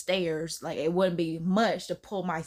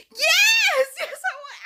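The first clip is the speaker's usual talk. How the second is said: much higher, much louder laughing yell; shouted yes; 7 dB louder, slower than usual. Retold or imitated. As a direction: scream yes through a laugh. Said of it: "I was just like".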